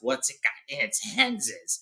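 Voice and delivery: slow, hissing creature voice; Gollum. A narrator's reading. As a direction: ask slowly in a creature's hiss. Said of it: "so he asks".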